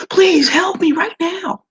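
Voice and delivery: high, slow, desperate plea; urgent pleading tone. Shown as a demonstration. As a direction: beg for help with real urgency. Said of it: "Now I added my tone in there".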